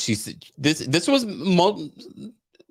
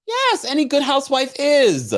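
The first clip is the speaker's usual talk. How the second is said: sassy; 14 semitones higher than usual. Real, not acted. High punchline remark.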